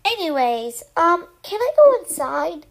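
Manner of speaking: impatient cloud wanting an answer. Acted. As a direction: ask impatiently, pushing the volume up. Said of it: "the cloud said impatiently".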